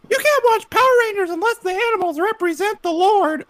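very high squeaky cartoon mom; mom in Mickey Mouse voice. Mom laying down religious TV rules, high Mickey Mouse voice. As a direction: squeak it out like a strict cartoon mother. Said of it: "doing my mom's voice and a Mickey Mouse voice".

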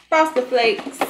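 ordinary talk, nothing marked

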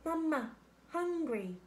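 put-on high, little baby-bird voice; peeping gosling. A narrator's reading. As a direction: peep like a hungry gosling. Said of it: "Brightbill started peeping".

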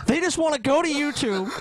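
high-pitched mock emotional plea; getting emotional. Pleading with Paul for the viewers, mock emotional. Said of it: "getting a little emotional".

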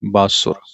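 ਬਾਸੁਰ with a short u after the s; careful pronunciation. Shown as a demonstration. Teacher spelling the word out, ਬਾਸੁਰ said carefully with short u. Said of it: "ਬੱਬੇ ਕੰਨਾ ਸੱਸੇ ਔਂਕੜ ਰਾਰਾ".

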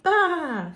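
high bleating baa; mock sheep baa. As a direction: bleat it like a lamb. Animal sound in farm rhyme.

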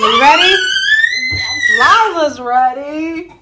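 excited dinner call; excited announcing. Real, not acted. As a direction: hype up the dinner. Mom excitedly announcing taco meal.

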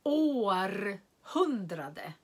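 Århundrade said slowly, syllable by syllable, long å; drawn-out teacher model of Århundrade; slow clear demo word; careful model word. Shown as a demonstration. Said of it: "Sen har vi ett sammansatt ord igen".

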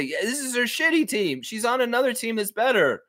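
high put-on voice; hammed-up blunt reply; cheeky team parent. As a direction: taunt the coach in a high pitch. Acts out the cheeky reply to coach.

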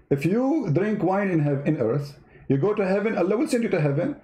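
ordinary talk, nothing marked

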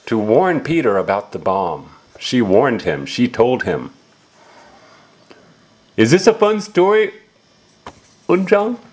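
ordinary delivery

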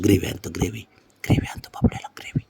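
soft coaxing whisper, nearly breath only; whispered treat promise. Real, not acted. Whispering to the pet about gravy to get him up, hushed.

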